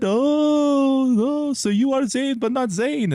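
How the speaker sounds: high, surprised put-on voice; lightly hammed-up high voice; high mock interviewer. Retelling interviewer's reaction.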